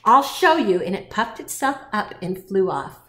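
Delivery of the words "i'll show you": loud, higher grouchy ladybug; storybook bully's boast; defiant threat. A narrator's reading. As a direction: throw it back defiantly, loud.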